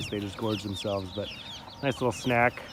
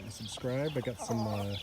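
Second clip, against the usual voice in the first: ordinary delivery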